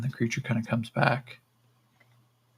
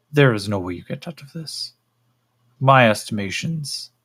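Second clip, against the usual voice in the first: measured, dismissive alien examiner; condescending creature; 3 semitones higher than usual. Acted. Creature dismisses captive's hope, condescending creature voice. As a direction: pronounce judgement on a naive captive.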